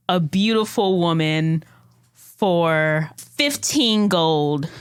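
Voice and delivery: slow, drawn-out showy announcement; loud, sweet voice. Acted. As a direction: call it out sweetly to the whole room, stretching the words. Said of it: "in a loud, sweet voice, she goes".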